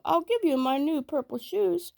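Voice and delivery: put-on high little boy begging; small boy pleading. A narrator's reading. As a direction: squeak out the plea to the tiger. Sambo offers shoes.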